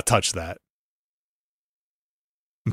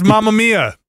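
higher mock Italian exclamation; lightly hammed-up Italian catchphrase; Mario's Mamma mia; 6 semitones higher than usual. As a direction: toss it off like the cartoon plumber. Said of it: "And he just says".

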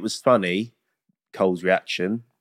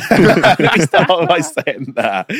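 laughing; 5 semitones higher, 5 dB louder, much slower than usual. Real, not acted. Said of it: "So this is my pod".

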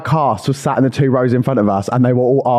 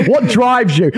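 higher, earnest interviewer question with a laugh; podcast host interviewer; 8 semitones higher, slower than usual. Pretend. Pretend interviewer asking a guest about drive, mock earnest. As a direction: ask it like a serious CEO interviewer. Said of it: "I sound like Stephen Bartlett".

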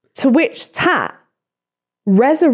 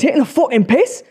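fast, indignant mock northern accent; quick broad northern challenge; 3 semitones higher, faster than usual. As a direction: accuse in put-on northern English. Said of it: "but was a northern accent".

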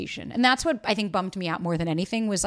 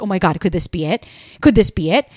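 hopeful excitement; 2 semitones lower, 7 dB louder than usual. Retold or imitated. Hopeful at every text.

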